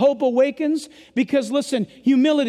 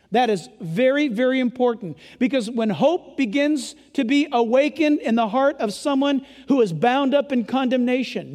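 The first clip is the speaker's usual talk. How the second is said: ordinary talk, nothing marked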